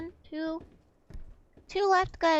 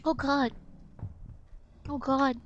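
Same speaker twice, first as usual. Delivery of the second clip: ordinary delivery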